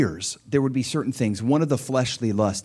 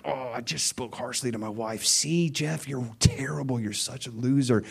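quiet self-reproach; 7 dB quieter than usual. Retold or imitated. Self-condemning inner voice.

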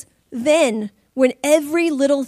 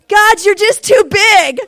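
loud, high, slowed exclamation of awe; overwhelmed, praising God loudly; awed praise; 8 semitones higher, 10 dB louder, slower than usual. Retold or imitated.

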